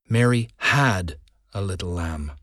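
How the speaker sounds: emphasize the word "had", sounding it out; stress on had. Shown as a demonstration. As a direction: lean on "had" and let the rest drop. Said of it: "emphasizing different words".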